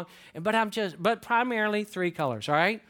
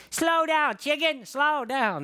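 grandchild's voice; 9 semitones higher, slower than usual. Retold or imitated. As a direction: play the grandbaby chasing a chicken. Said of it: "And she's like".

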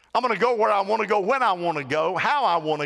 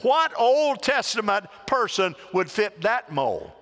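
ordinary talk

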